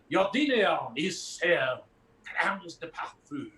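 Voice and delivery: put-on slow, grand French accent; French waiter. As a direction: announce the dish slowly in a thick French accent. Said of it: "it says a French waiter".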